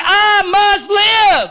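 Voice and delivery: slow, loud, high shout of I must live; shouted call. Shown as a demonstration. Preacher rousing the congregation, slow shout. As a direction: cry it out slowly for all to repeat. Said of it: "Come on, say it with me".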